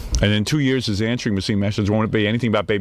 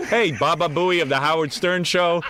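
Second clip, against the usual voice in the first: mock ad announcer; 4 semitones higher than usual. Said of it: "He even puts out flyers".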